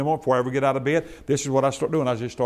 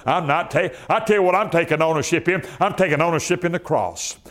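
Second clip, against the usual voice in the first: higher, louder, driving preacher's cry; full-force preaching; 7 semitones higher, 3 dB louder than usual. Acted. Pastor urging his congregation on health, preaching hard.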